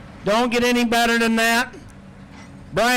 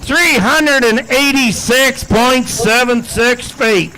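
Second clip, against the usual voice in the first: play-by-play announcer; 6 dB louder than usual. Acted. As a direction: sell the pull to the grandstand. Calls the pull over music.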